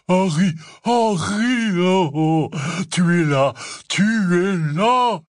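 loud, high, drawn-out cries of delight; big slow exultant shouts; overjoyed shouting. A narrator's reading. Hagrid finding Harry after his fall, overjoyed shouting.

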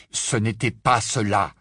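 ordinary delivery, nothing marked

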